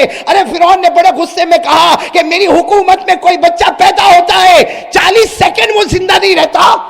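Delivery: high, loud fiery storytelling; loud preaching. A narrator's reading. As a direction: thunder the king's decree. Preacher retells Pharaoh's fury. (جوشیلا بلند بیان)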